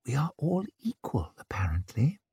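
unvoiced conspiratorial whisper; whispering aside. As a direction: murmur it slyly out of the side of the mouth. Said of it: "speak out of the side of her mouth".

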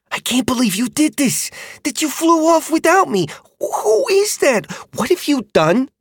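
put-on a dismayed young dragon rider; rider girl, upset. A narrator's reading.

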